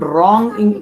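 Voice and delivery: ordinary talk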